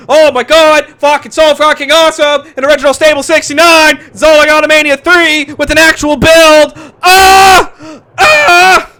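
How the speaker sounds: high loud screams of joy; ecstatic screaming. Real, not acted. Fan hearing the big match is set, screaming. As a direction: scream like the best news ever.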